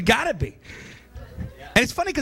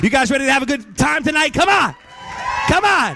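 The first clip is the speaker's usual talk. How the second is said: crowd hype; 6 semitones higher than usual. Real, not acted. Hyping the crowd.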